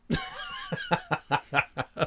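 high-pitched laughter; real high laugh. Real, not acted. Laughs at the story.

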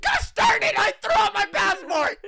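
high, loud mock wail at his own mistake; retold panicked shout. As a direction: shout it like you just realized you trashed it.

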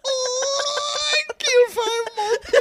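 extremely high howl; hammed-up sobbing squeal; mock wailing.

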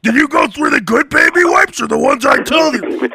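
wife and husband squabbling over baby, mock-shrill; mock bickering couple. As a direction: bicker like a frazzled parent. Acted couple quarrel.

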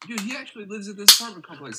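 high, loud imitation; excited mock retelling. Friend repeats that Mark lives here, high excited imitation.

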